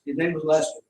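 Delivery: ordinary talk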